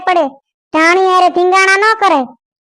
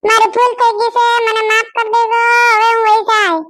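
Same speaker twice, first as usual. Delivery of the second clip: put-on higher young wife's voice, apologetic; young woman pleading; 5 semitones higher than usual. Kayadi begging her in-laws to forgive her, pleading. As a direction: plead for forgiveness like a scolded bride.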